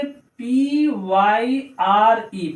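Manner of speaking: very slow, stretched letter names; spelling out. Shown as a demonstration. Teacher spelling pyre for learners, slow letters. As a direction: spell it out one letter at a time.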